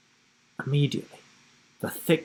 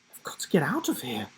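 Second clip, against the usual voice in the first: fast, breathless unvoiced plea; hoarse pleading whisper; 7 dB quieter, much faster than usual. A narrator's reading. Trapped boy pleading to escape the cage, whispering fast. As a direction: whisper it quickly, panicked to escape. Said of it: "he pleaded hoarsely".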